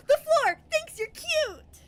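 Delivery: slow, high, loud drawn-out shout; excited yell. Retold or imitated. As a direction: bounce and shout it at the kid. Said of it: "almost yelling it".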